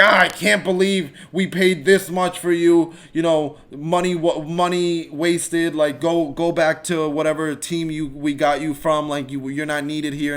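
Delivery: imitating a bitter fan posting online; mock angry fan. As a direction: whine like an online fan attacking a player. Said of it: "go online and be like".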